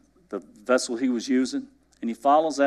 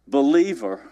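ordinary delivery

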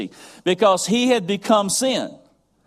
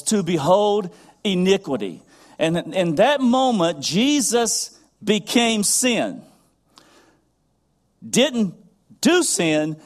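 preaching build; 3 semitones higher than usual. Acted.

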